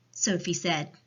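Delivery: ordinary delivery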